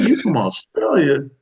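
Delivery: amazed disbelief. Retold or imitated. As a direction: draw it out in disbelief. Learning she is from Australia.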